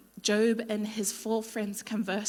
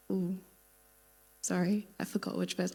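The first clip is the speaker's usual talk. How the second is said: whispered aside; 3 semitones lower, 4 dB quieter than usual. Real, not acted. Aside while losing her place.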